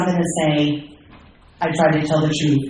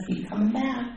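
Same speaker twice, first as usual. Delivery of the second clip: soft and smiling, pitched up; gentle, smiling; 6 semitones higher, 8 dB quieter than usual. Retold or imitated. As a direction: say it like a kindly welcome. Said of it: "just smiled and said".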